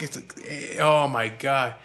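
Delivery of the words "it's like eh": plain reading voice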